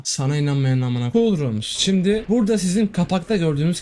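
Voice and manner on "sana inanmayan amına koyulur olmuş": normal talking voice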